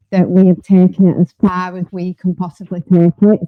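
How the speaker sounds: mic buried in her top; muffled. Real, not acted. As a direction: voice muffled by clothing. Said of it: "You, you muffled".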